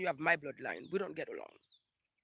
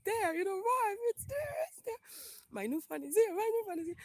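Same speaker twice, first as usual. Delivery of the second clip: very high-pitched gleeful squealing; giddy excitement; 4 dB louder than usual. Real, not acted. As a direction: squeal with joy over a new arrival. Celebrating her new phone's arrival.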